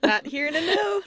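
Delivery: high, laughing through the words; cracking up mid-sentence. Real, not acted. Co-host laughing at the Canadian accent joke, laughing.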